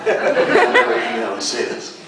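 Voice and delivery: high, slow words through laughter; laughing. Real, not acted. Voice actor laughing at his own struggle with names, high and slow. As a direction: laugh it out, helpless.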